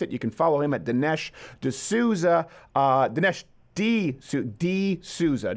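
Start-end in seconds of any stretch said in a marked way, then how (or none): 2.7-5.5 "D Souza" slowly, D as the letter, then Souza; D Souza pronunciation. Shown as a demonstration. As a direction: say "D Souza" like the letter D plus Souza, carefully. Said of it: "sometimes we're like, "I can't find him," and they're doing D I".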